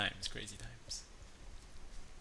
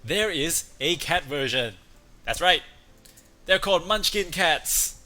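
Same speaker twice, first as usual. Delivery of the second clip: excited reveal; 6 semitones higher, 9 dB louder than usual. Real, not acted. Excited reveal of cat breed.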